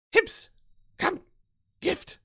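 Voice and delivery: high, squeaky little goblin cries; tiny goblin piping up, word by word. Acted. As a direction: squeak out each word in short bursts. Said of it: "squeaky voice".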